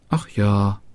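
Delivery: ordinary delivery, nothing marked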